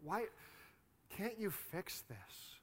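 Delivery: hushed, slow plea to God; soft appeal with long pauses; pleading prayer. Retold or imitated. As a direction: imitate a weary prayer, softly and haltingly. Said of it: "and saying".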